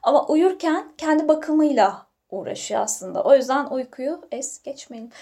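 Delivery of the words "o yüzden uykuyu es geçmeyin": ordinary talk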